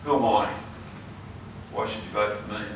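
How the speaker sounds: ordinary delivery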